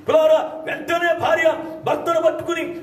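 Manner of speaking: preaching at full force. Acted. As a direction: proclaim it to the whole congregation.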